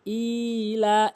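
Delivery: ইলা with a long held ই; correct elongation. Shown as a demonstration. Teacher shows how to read it, drawn-out ইলা. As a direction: chant ইলা slowly, stretching both vowels. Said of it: "এভাবে পড়তে হবে".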